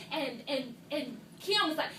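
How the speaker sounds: usual speaking voice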